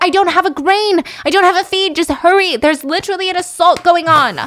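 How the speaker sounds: high, loud panicked yelling; acting out a woman's shrill panic; freaking out. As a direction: plead frantically with useless emergency operators. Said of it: "Halam is freaking out".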